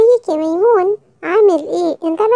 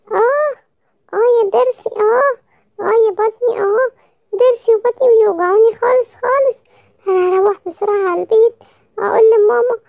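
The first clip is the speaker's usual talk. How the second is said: groaning in pain; 3 semitones higher than usual. Acted. Child's toothache and bellyache.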